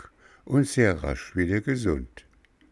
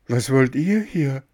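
high, startled question; acted fright, pitched up; frightened cry; 8 semitones higher, 4 dB louder than usual. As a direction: call out in alarm as a puppet boy. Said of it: "rief Bengele und setzte sich voller Schrecken".

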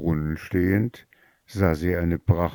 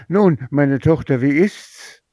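higher and louder, expectant; the mother calls out to her returning daughter eagerly; 7 semitones higher, 5 dB louder than usual. Acted. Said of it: "rief sie ihr entgegen".

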